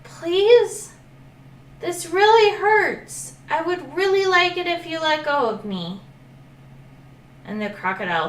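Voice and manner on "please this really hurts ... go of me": high, pleading little voice; pleading little dragon. Acted. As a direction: beg sweetly to be freed. Dragon begs crocodile.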